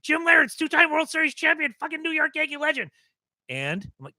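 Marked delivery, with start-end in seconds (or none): 0.0-2.9 high, loud and thrilled, speeding up at the end; ecstatic shout. Retold or imitated. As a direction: shout it like a giddy fan who just got the call.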